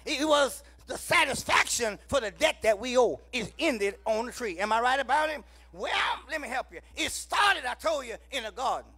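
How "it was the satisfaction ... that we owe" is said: loud, rhythmic whooping sermon cadence; full-force preaching. Acted. Preacher rousing the congregation, loud whooping preaching. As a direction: preach it out to the whole church.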